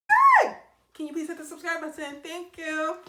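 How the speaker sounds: sudden high shout, then sing-out request; high-pitched plug. Pretend. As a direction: break in with a high, perky call to viewers. Breaks off story to call viewers.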